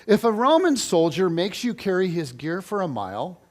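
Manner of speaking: ordinary talk, nothing marked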